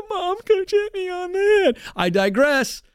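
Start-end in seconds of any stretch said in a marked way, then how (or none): 0.1-1.8 very high mock tearful child voice; hammed-up whining to a parent; whiny kid. Kid tattling on the coach to Mom, mock whiny voice.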